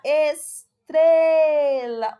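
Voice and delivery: estrela with TRE very loud and long; stress on TRE. Shown as a demonstration. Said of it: "O tre". (estrela com TRE bem forte e esticado)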